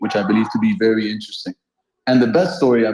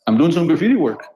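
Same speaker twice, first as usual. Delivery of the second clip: casually tossed off, a touch higher; super chilled; 6 semitones higher than usual. Retold or imitated. Ju Zapata answering the cop, utterly chilled. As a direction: shrug it off like it's nothing. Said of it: "in the most chilled way possible".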